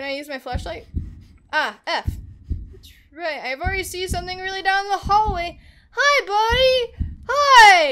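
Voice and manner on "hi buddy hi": high, loud, drawn-out hello; big high-pitched greeting; excited greeting. Acted. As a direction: call out loudly to a little buddy. Calling to a figure in game.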